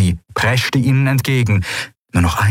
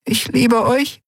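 much higher, small and fragile; slower than usual. A narrator's reading. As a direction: say it as if it might break. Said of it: "mit zerbrechlicher Stimme". (zerbrechliche, hohe Stimme)

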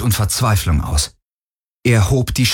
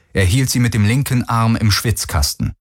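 plain reading voice

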